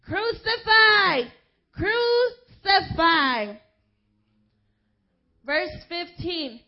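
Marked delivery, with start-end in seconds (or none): 0.1-3.6 very high, loud, slow drawn-out cry; mob shout. Acted. Preacher voicing the chief priests, slow furious shout. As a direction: bellow it like an angry mob. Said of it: "they shouted".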